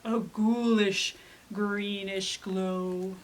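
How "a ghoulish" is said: usual speaking voice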